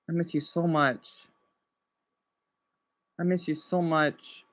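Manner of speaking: soft, low wistful murmur; quietly wistful, close to getting emotional. Real, not acted. Streamer missing a closed store, softly emotional. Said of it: "I'm gonna get a little emotional".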